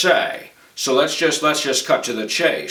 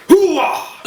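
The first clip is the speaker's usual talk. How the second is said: much higher, louder hoo-ah bark; loud gung-ho soldier; 6 dB louder than usual. Retold or imitated. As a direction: shout it like a colonel. Soldier catchphrase shout.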